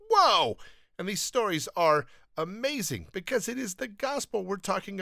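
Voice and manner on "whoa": higher, louder imitated gasp of wonder; amazed whoa. Reader stunned by a Bible passage, higher, louder whoa. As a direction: let the awe burst out. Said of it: "you're like".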